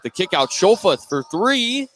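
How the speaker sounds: ordinary talk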